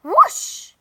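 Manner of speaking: a sharp rising whoosh, very loud and high; whoosh sound effect. A narrator's reading. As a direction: swoop the word up like a gust. Broom takes off.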